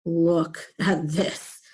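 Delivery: low, slow, marvelling aside; imagined onlooker's voice. Acted. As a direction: take it in slowly, mildly amazed. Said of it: "might sound a little bit like".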